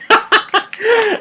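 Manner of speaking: high-pitched laugh; laughter. Real, not acted. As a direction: laugh heartily at your own remark. Laughs at own remark.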